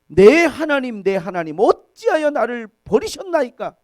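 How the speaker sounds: high, loud recited lament; anguished cry to God. Retold or imitated. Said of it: "너무 고통스러우니까".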